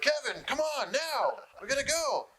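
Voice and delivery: high, slow, whiny manager voice; nagging manager. Retold or imitated. Security guy whining at Kevin to hurry, high and slow. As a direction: drag out the nag like a fussy handler.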